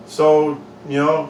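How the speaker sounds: usual speaking voice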